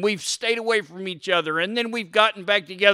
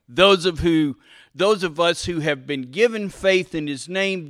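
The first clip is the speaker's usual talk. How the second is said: normal talking voice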